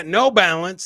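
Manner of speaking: ordinary talk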